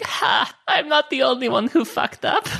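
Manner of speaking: high, mocking gloat from the floor; laughing taunt while lying prone. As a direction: rub it in with a smirk. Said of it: "be like".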